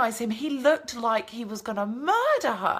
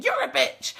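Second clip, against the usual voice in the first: high, sharp mock shriek. Imitating a housemate shrieking at a girl, high mock yell. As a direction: throw out the insult in a high screech. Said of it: "it just showed Naman saying".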